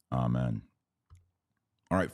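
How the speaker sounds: ordinary talk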